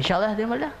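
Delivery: ordinary delivery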